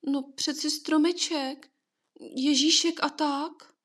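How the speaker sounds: high, slow words with tears close; swallowing tears, voice stretched thin; holding back tears. A narrator's reading. Boy near tears tells his mother about the tree, slow and high. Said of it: "začal polykat slzy".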